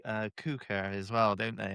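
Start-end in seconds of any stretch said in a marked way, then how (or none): 0.4-0.7 "cooker" said with a long oo vowel; "cooker" with the oo drawn as in "food"; local pronunciation. Shown as a demonstration. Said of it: "and they say".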